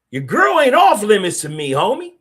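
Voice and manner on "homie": ordinary delivery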